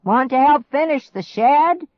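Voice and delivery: loud, high call, lightly voiced old man; grandpa yelling. A narrator's reading. Grandpa yelling to the kids while waving a saw. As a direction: holler the offer across. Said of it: "he yelled".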